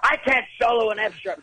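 high-pitched imitation of an exasperated rock star; mock scream. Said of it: "you're being screamed at".

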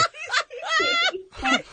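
high giggling laugh; can't stop laughing; real laughter. Real, not acted. Co-host laughing.

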